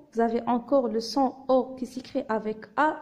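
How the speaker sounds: ordinary delivery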